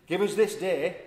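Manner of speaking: high, loud Yorkshire prayer; Yorkshire accent. Acted. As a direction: proclaim in broad Yorkshire. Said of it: "In a Yorkshire accent".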